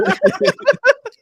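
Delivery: loud high-pitched cackle; high laugh. Real, not acted. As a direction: crack up loudly. Laughing at love handle.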